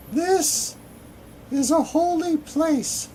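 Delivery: very high, loud falsetto maiden; man badly faking a woman's high voice; failed feminine voice. A narrator's reading. Forbidding a stranger from entering the temple, in failed feminine falsetto. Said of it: "like a sixty-two-year-old man trying to sound like a woman and failing".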